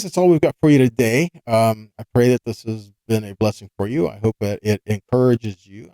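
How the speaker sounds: usual speaking voice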